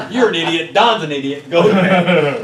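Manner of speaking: loud, high, exasperated scolding through a laugh; annoyed wife, laughing. Retold or imitated. Wife scolding her husband at night, loud and laughing. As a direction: scold him back to bed. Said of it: "She goes".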